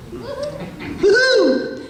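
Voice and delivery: wild whoop way up high; goofball whoop. Shown as a demonstration. Showing the goofy characters he gets cast as, high whoop. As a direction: cheer like a cartoon goofball. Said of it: "I generally play the goofballs".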